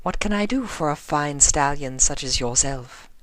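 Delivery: high, teasing purr; flirtatious lady. Acted. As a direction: bat the eyelashes with your voice. Rarity teasing the officer.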